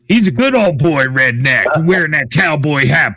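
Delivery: normal talking voice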